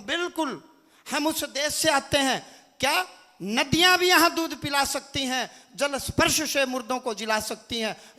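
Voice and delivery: loud, emphatic rally oratory; real preacher's fervour, projected loudly; full-force preaching. Real, not acted. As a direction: proclaim it to a big crowd.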